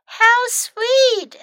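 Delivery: high, loud gushing; pretty fox voice. A narrator's reading. Pretty fox receives the chocolates, high gushing voice. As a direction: coo sweetly over a gift.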